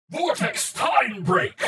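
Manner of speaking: high, very slow drawn-out device call; belt call. A narrator's reading. The belt rings out the final attack, high and drawn out. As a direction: ring out the move name. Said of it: "The belt rang out".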